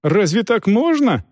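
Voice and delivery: high, indignant question. A narrator's reading. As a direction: protest with pitch climbing high. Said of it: "возмущался капитан".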